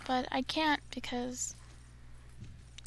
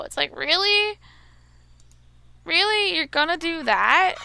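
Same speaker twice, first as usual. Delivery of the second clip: loud, higher incredulous rant; loud exasperation; 6 semitones higher, 9 dB louder than usual. Real, not acted. Gamer scolding the fleeing chicken, loud incredulous rant. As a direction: demand it in disbelief.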